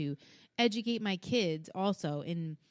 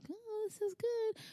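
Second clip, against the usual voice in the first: mock sentimental; 6 dB quieter, slower than usual. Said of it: "not to get all sentimental".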